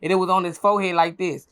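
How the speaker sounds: ordinary talk, nothing marked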